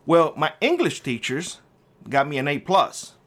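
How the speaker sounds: ordinary talk, nothing marked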